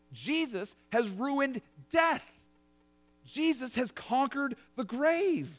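ordinary delivery

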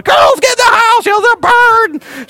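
put-on high loud slow scream at the kids; retold scream. Wife calling her girls into the house, mock high scream. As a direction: yell the girls indoors in a mock panic. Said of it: "She's screaming about it".

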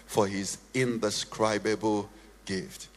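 soft prayer voice. Acted. Pastor leading the church in quiet prayer. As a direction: pray quietly into the microphone. Quiet ministry over music.

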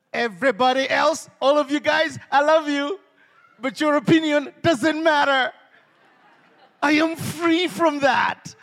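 loud, high preaching cry; preaching at full force. Acted. Preacher crying out to the congregation, loud and high. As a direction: build to a rousing shout.

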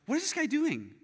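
high-pitched disbelief; bewildered inner voice. Retold or imitated. Golfer watches the man charge, bewildered.

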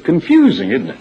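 laughs out the word confusing; amused exasperation breaking into a laugh; real laugh at a muddled text. Real, not acted. Mocking the convoluted text.

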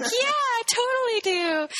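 high, slow mock-child reply; imitated eager kid. Kid answering dad's bar invitation, mock eagerness. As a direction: play an over-keen little kid.

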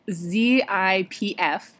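Z I P F, letter by letter, slowly; spelling it out. Shown as a demonstration. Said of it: "it's spelled".

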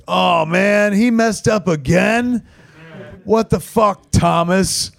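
mock exasperation. Jay to Thomas after a flubbed line, mock exasperated. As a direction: whine at a colleague's mistake, hammed up. Retold complaint of Jay at flub.

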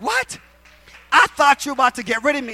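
high, loud, astonished outburst; mock disbelief. Retelling the news of promotion, high incredulous reaction. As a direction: act out being completely thrown.

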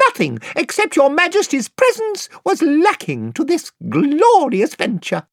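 very high, piping, courtly little voice; shrill piping mouse. Acted. Reepicheep greeting Queen Lucy in a piping voice. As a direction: pipe it like a tiny gallant knight. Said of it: "in his shrill, piping voice".

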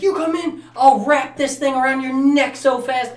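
high, loud threatening rant; hammed-up angry warning; mock threat. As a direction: play a worked-up man warning them off. Acts out chiropractor threatening staff.

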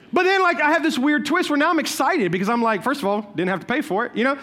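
ordinary delivery

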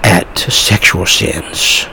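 hushed whisper, slowed right down. Shown as a demonstration. Preacher mocking whispered sermons, in a slow whisper. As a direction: breathe the words out in a slow whisper. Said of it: "God always whispered".

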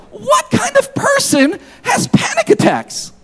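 high exclamatory cry; exasperated high-pitched outburst; self-questioning outburst. Retold or imitated. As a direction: throw the question up high in disbelief. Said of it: "I was asking myself".

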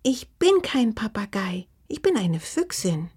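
indignant little fox, starting high; piqued creature voice; offended. A narrator's reading. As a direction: correct them in a huffy small voice. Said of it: "berichtigte Oma mal beleidigt".

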